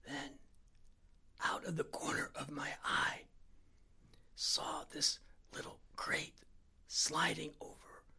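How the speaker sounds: slow, halting whisper, word by word; terrified whisper, pausing between phrases; slow careful whisper. A narrator's reading. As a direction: whisper each word without moving your stomach. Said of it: "he spoke very slowly".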